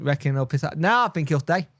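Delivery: ordinary talk, nothing marked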